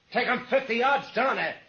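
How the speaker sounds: tense outlaw order; barked order. Acted. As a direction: push the driver with your voice. Gunman orders driver.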